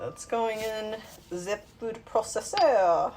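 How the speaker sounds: a playful mock French accent; fake French accent. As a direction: toss it off in a jokey French accent. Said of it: "that fake French accent".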